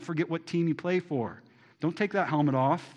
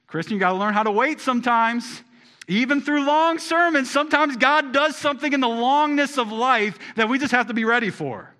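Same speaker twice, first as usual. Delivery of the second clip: higher, louder preaching push; full-force preaching; 11 semitones higher, 9 dB louder than usual. Acted. Preacher urging the congregation to wear compassion, at full force. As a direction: drive it home to the pews. Preacher urges patience loudly.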